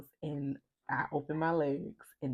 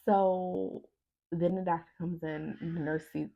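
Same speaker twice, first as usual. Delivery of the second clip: ordinary delivery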